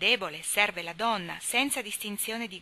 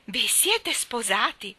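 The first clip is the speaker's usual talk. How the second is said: shocked; 4 semitones higher, 6 dB louder, much slower than usual. A narrator's reading. Joe pounces on Laurie.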